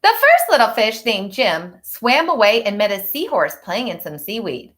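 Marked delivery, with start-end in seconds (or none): none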